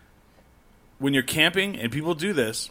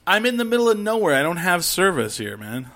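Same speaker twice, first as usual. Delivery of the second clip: exasperated retort; 6 semitones higher than usual. Acted. Camper answering a useless text, exasperated. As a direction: protest it in a rising rush.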